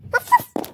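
very high, airy little puppy barks; high breathy yaps. Pretend. Puppy answering its owner, very high airy yaps. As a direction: yip like a tiny excited puppy.

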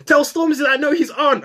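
high, loud mock aunt voice; aunt sending a message to a star; imitating aunt. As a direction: play the eager aunt. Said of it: "my aunt was like".

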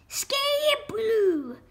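turkey hen crying out; mild scream. A narrator's reading. Madame Waddle screams as she chases off, mild scream. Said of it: "screamed Madame Waddle".

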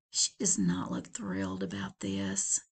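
low hushed whisper; whispered aside. Real, not acted. Narrator commenting on the wife, hushed whisper. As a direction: confide it quietly.

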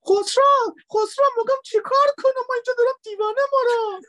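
very high, loud squeaky woman's voice; panda wife voice. Acted. Panda wife behind glass calls her husband, high frantic woman's voice. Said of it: "هم صدای زنه رو گذاشتم".

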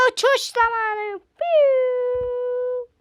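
high, loud, playful launch cry; excited flight call. Acted. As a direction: announce takeoff with glee.

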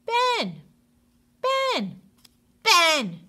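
loud, high repeated call; teacher calling a daydreaming pupil. Acted. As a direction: call his name across the room.

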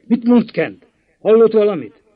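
loud, high, anxious demand for news; urgent loud question. Acted. Master anxiously demanding news from the housekeeper, loud and high. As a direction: press the servant for news, alarmed.

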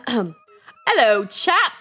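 badly faked English accent. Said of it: "[name] cannot do an English accent".

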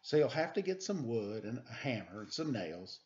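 put-on lively read-aloud for children; storybook reading. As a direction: read it like a bedtime picture book. Reads picture book aloud.

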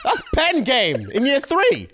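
high, drawn-out laughing exclamation; amazed laughing. Real, not acted. Reacting to a child's yearbook quote, high laughing amazement.

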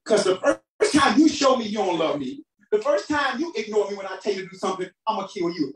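ordinary delivery

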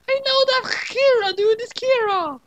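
very high, loud squeal of discovery; screaming. Acted. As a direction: squeal it out like a shrill cartoon girl. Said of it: "the girl from the bus screamed".

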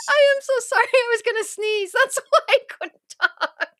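high, giggling apology; laughing. Real, not acted. Laughing apology after sneeze.

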